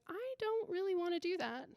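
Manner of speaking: high, soft reluctance; reluctant child. Retold or imitated. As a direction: imitate a kid quietly balking. Son balks at confronting the kid.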